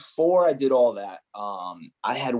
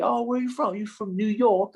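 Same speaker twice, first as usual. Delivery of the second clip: high-pitched take on nosy Englishmen; imitated English players; 12 semitones higher than usual. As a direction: mimic English lads quizzing the American, pitched way up. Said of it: "all the English people are like".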